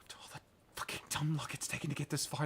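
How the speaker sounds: hurried, half-whispered despair; quick hushed bitter lament. Acted. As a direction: whisper it fast as hope runs out. Character giving up while falling.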